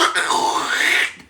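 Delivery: loud harsh voiceless noise; imitated noise. Storyteller imitating the noise heard from the bed, loud. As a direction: make a sudden rough sound effect.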